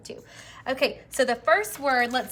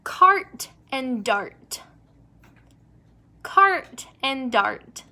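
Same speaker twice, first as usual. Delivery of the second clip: slow, even teacher read; slow rhyme demo; 4 dB louder, much slower than usual. Shown as a demonstration. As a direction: draw out each word for the class. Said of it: "If they do rhyme, thumbs up".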